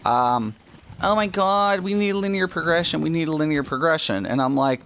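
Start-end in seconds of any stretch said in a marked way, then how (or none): 1.0-4.3 high-pitched pleading mock whine; whiny, hurried mock complaint. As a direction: imitate a whiner begging, words tumbling out. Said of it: "a lot of people whine and Q Q".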